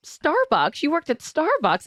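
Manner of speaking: high, quick surprised questions; imitating a dad's amazed double take; mock astonished reading aloud. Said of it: "and he's like".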